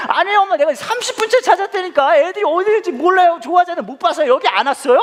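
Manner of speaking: very high, loud frantic protest; acted panic at the top of the voice; feigned panic. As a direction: insist in hammed-up desperation. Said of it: "그러더니 제가 또 얘기했죠".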